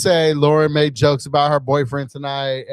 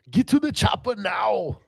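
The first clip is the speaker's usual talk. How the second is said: higher, strained action-star cry; Arnold Schwarzenegger; 7 semitones higher, 5 dB quieter than usual. Acted. Host asked about an action star impersonation, strained action-hero impression. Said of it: "Can you do an Arnold Schwarzenegger impersonation?".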